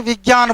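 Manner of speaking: विज्ञान said "vigyan", with a g. Shown as a demonstration. Teacher showing what everyone says, everyday gy in विज्ञान. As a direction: put gy in the middle of विज्ञान. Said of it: "लेकिन वैसा कोई तो बोलते नहीं".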